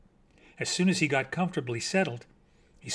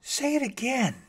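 higher, eager plea to hear it again; surprised and pleased; 9 semitones higher than usual. A narrator's reading. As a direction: ask with happy surprise. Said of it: "in a surprised, pleased voice".

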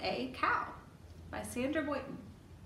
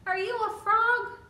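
high, loud, playful question; excited question; 10 semitones higher, 9 dB louder than usual. Acted. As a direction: ask it with big surprise.

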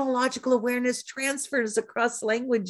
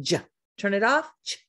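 ordinary talk, nothing marked

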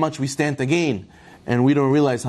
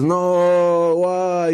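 drawn-out, higher mock wail; mock crying; 4 semitones higher than usual. King wailing to his minister over lost money, mock crying. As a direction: wail the words long, as if crying. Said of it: "He starts crying".

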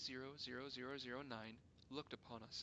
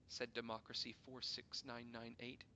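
plain reading voice

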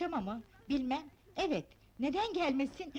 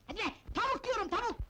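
quick, higher-pitched exasperated repeat; exasperated yelling; 5 semitones higher, faster than usual. Acted. Mistress repeats the word to muddled maid, exasperated yelling. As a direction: snap it at someone not understanding. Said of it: "ne bağırıyorsun".

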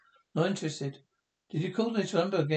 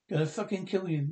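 usual speaking voice

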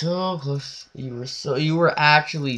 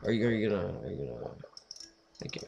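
ordinary talk, nothing marked

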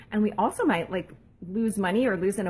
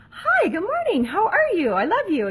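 much higher, sunny and loud; bright cheery greeting; 10 semitones higher, 6 dB louder than usual. Pretend. Mom greeting her teen daughter at the door, bubbly and high. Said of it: "instead of saying something like".

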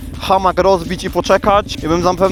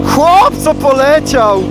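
amazed; 5 semitones higher, 4 dB louder, slower than usual. Real, not acted. Amazed at a speeding driver.

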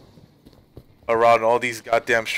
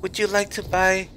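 imitating a little girl, much higher; sweet, high mock child's pitch, a bit softer; little girl's voice; 8 semitones higher, 4 dB quieter than usual. Little girl offering her paintings on the train, higher mock child voice. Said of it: "and then she goes".

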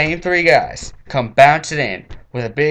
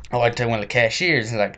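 ordinary talk

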